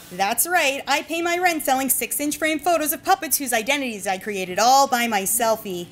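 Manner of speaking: normal talking voice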